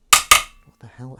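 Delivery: taken aback by something stuck; surprised outburst. Real, not acted. Sudden mess in sink.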